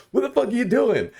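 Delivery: high mock yell; imitated angry demand. Homie with the M70 confronting him, mock angry. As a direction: snap it like an armed guy confronting you. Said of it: "He's like".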